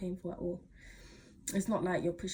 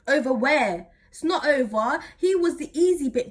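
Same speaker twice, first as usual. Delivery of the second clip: indignant; 5 semitones higher, 8 dB louder than usual. Real, not acted. Indignant rebuttal.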